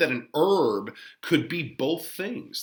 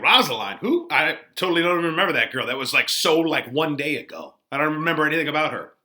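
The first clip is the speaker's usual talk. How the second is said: fast, higher, louder brush-off; dismissive Romeo; 4 semitones higher, 6 dB louder, faster than usual. Retold or imitated. Teacher paraphrasing Romeo who has forgotten Rosaline, fast and flustered. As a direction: rattle it off like a flustered teen. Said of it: "And he's like".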